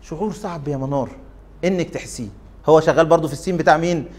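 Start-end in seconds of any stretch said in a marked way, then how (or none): 0.0-2.3 much softer, gentle words of comfort; kindly. Retold or imitated. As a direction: say it kindly, like handing her a biscuit. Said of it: "قال بطيبة".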